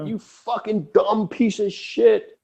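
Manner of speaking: high, slow mock insult. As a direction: snarl it like a furious rock star. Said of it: "And [name] was like".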